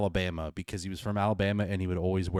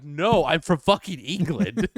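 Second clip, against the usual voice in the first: loud, high, drawn-out mock outrage; mock indignant; 8 semitones higher, 7 dB louder, slower than usual. Brit mistaken for an Australian, mock indignant. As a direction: protest it slowly, hammed-up.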